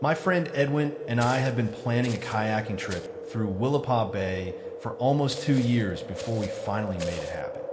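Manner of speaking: usual speaking voice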